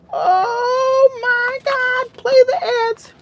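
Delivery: very high squealing cry. Real, not acted. Owner squealing in falsetto at the excited dog.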